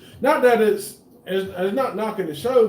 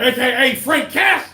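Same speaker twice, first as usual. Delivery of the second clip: hyped-up announcer call, higher and louder; hype intro; 6 semitones higher, 6 dB louder than usual. Acted. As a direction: shout out his nickname like a ring announcer. Hyping up the guest's nickname.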